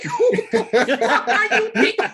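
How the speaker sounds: high, loud, slow disbelief with a laugh; incredulous. Retold or imitated. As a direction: drag it out in mocking disbelief. Incredulous reaction to the tall tale.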